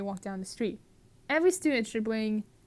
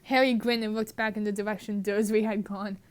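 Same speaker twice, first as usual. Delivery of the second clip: ordinary talk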